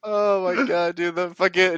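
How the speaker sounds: usual speaking voice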